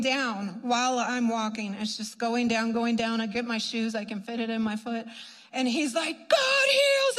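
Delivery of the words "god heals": much higher and louder "God heals"; triumphant shout. Retold or imitated. Ex-prisoner exults at healing.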